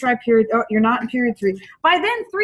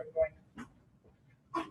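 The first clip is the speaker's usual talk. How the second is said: usual speaking voice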